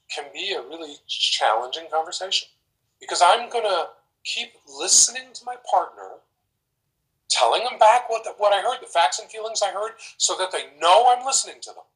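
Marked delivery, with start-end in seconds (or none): none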